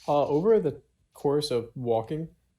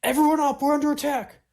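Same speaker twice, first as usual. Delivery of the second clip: loud, high shouted warning; raised-voice battle alert, pitched way up; yell of alarm; 14 semitones higher, 8 dB louder, faster than usual. Acted. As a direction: yell out to rouse a sleeping camp. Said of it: "just kind of yell".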